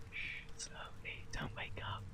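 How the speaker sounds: very soft unvoiced whisper. Real, not acted. Parent easing hand from sleeping baby, barely whispered.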